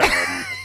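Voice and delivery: high shrieking laugh; burst of laughter. Real, not acted. Big laugh at own story.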